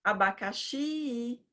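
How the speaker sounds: abacaxi with XI stretched long; slow sing-song call of abacaxi, lingering on XI; calling the word. Shown as a demonstration. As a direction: hail abacaxi like a person, holding the final XI. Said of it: "longa é o xi na chamada".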